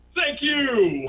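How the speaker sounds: loud, high singled-out thanks; lightly voiced grateful dude calling over; imitated cool dog owner.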